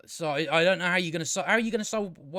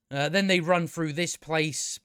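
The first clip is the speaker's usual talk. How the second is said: ordinary delivery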